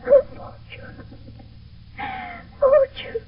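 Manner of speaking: high, slow, breaking appeal to fortune; anguished cry. Acted. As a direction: cry out to fate in despair. Juliet cries out alone.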